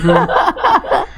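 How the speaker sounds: high laugh. Real, not acted. Laughing at the story.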